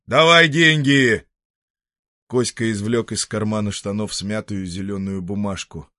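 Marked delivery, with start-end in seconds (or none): 0.1-1.3 громко, медленно, властно; потребуй жёстко; грубое требование. Acted. Хозяин требует деньги. (хозяин громко требует деньги у сироты)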